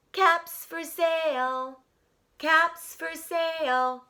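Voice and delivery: slow, high sing-song street cry; peddler calling. A narrator's reading. Peddler calls to the street, slow and high. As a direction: call out the wares slowly.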